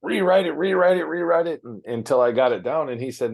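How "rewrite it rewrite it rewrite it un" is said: higher and louder each rewrite it; insistent repetition. Real, not acted. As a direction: hammer the words rewrite it, pitched up. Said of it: "repeating to show the effort".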